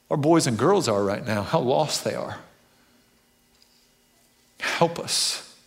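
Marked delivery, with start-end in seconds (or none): 4.6-5.6 breathy whispered plea to God; whispered prayer. Real, not acted.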